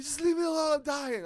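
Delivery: high-pitched, very loud pleading outburst; retold desperate yell. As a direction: re-enact screaming at mom in agony. Retold yelling at mom while sick.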